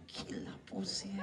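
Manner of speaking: breathy whispered aside to the crowd. Acted. Comic whispering a crude aside to the audience. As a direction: hiss it under your breath.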